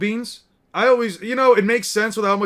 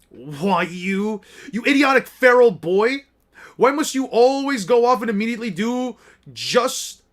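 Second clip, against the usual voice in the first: louder, higher angry rant; acted outrage, pitched up; exasperated scolding; 4 semitones higher, 5 dB louder than usual. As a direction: tell off a troublesome boy. Character scolds the feral boy.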